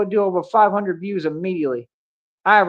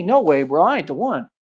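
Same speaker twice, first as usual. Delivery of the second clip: usual speaking voice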